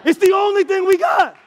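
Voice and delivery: high, loud preacher's cry; full-force preaching. Real, not acted. Preacher to congregation about grace, full-force preaching.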